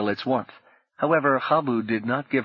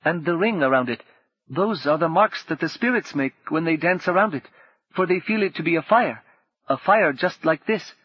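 a hint of a higher, earnest old guide; lightly voiced Habu; 6 semitones higher than usual. A narrator's reading. Habu explaining the moon ring to Rini, lightly voiced earnest elder. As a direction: tell it like a gentle elder explaining spirits.

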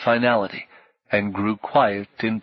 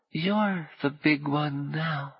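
high, much softer and slow murmur; 6 semitones higher, 5 dB quieter, slower than usual. A narrator's reading. Boy half asleep murmurs to Paul, softly. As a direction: drift back to sleep as you say it. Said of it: "Gally murmured".

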